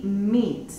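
"meat" with a long ee. Shown as a demonstration. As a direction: say "meat" as "miit". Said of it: "E A suena como I". ("meat" con i larga)

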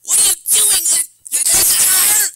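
very high, loud scolding mother; hammed-up high-pitched mom tirade; frantic mom impression. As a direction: shriek it like a mom pouncing on her kid. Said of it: "my mom, she comes out of nowhere like a panther".

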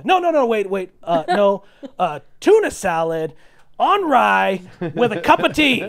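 loud, high burst of correction; emphatic reversal. Acted. George changing his lunch order at the waitress, emphatic reversal.

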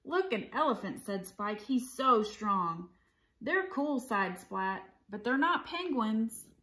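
animated picture-book read-aloud; story narration. A narrator's reading. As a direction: read it to children with clear, lively phrasing.

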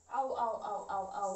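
sharp ows from a side stitch; pained ows. Real, not acted. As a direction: wince out a string of ows. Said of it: "I have a stitch".

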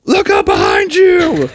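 high, slow, loud warning yell; shouted warning. Retold or imitated. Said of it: "he's just like".

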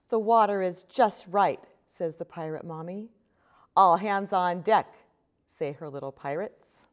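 put-on lively storybook read-aloud for kids; reading to children. A narrator's reading. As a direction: read it like story time at the library. Reads picture book to children.

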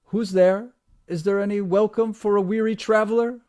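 loud, high, bold call across the glade. A narrator's reading. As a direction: announce yourself boldly to the house. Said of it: "calling out boldly".

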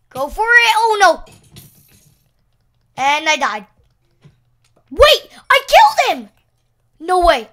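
high loud frantic yelps; frantic excitement. Real, not acted. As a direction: panic then disbelief. Chaotic fight.

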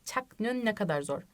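ordinary talk

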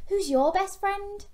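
put-on high, bright-eyed question to the kids; high cheery question to little listeners; asking the kids. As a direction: lift it right up for the children. Asking the children directly.

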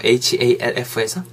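ordinary talk

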